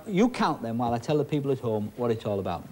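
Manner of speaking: normal talking voice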